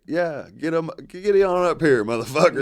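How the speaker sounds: mocking taunt. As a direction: egg him on, come get me. Taunting the boyfriend retold.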